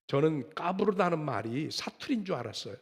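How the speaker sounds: ordinary talk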